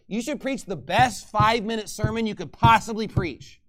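ordinary talk

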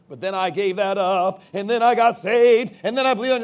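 hammed-up convert telling his story, swelling to a peak; mock testimony. Mocks a soul winner's testimony.

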